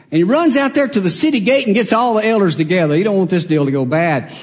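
ordinary talk

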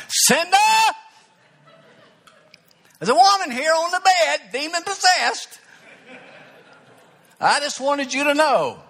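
high, loud call across the house; hollering for his wife, half alarmed. Retold or imitated. Pastor calling upstairs to his wife, loud and high.

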